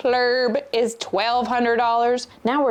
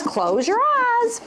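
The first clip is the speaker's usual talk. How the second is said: put-on boyfriend's line; 13 semitones higher, much slower than usual. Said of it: "And he says".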